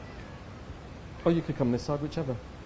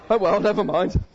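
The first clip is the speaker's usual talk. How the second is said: laughing; 4 dB louder than usual. Real, not acted. Spill mishap, laughing.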